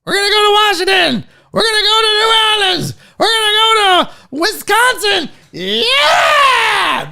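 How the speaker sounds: high, fast, shouted rally cry; Howard Dean scream. Retold or imitated. Streamer imitating a politician's rally scream to the crowd. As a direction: scream the list of states like a crazed campaign rally. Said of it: "that Howard Dean".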